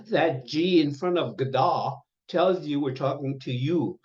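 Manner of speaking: ordinary talk, nothing marked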